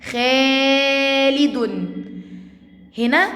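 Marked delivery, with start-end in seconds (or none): 0.1-1.9 خالد very slow, higher, with a long held aa; elongated madd. Shown as a demonstration. Teacher showing madd with alif, elongated reading. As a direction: hold the aa of خالد steadily, then finish. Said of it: "مد بالالف".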